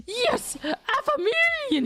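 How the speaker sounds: high, drawn-out retold shout; mock shout. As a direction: imitate a triumphant shout. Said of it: "he shouted".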